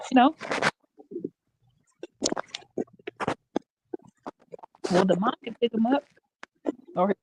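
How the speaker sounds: sounding through a wall; muffled, cutting in and out. Real, not acted. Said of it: "You sound muffled".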